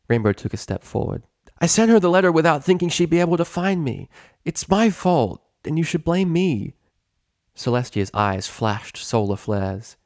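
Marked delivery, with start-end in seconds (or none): 1.6-6.7 put-on higher, louder, brash young pegasus pleading; tomboy pegasus. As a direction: take the blame boldly, higher. Rainbow takes the blame.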